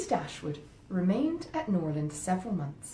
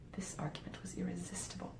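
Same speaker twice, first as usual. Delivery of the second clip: usual speaking voice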